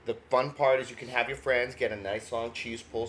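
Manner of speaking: normal talking voice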